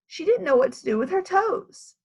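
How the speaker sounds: ordinary delivery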